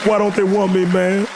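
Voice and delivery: high, loud mock sob; mock wail. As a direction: whine it into her shoulder. Wailing in the fan's arms.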